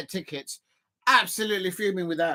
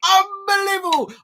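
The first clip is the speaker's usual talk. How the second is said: disbelief; 11 semitones higher, 5 dB louder than usual. Real, not acted. Reacting to the draw.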